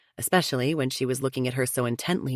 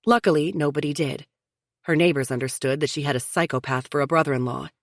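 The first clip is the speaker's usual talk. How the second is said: ordinary delivery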